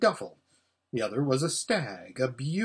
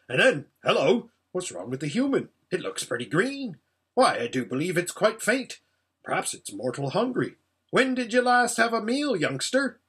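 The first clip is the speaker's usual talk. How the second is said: raised, animated dwarf voice; brisk, concerned dwarf; 5 semitones higher, 3 dB louder than usual. Acted. Dwarf noticing the human looks faint, concerned. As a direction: fuss bluffly over a faint stranger.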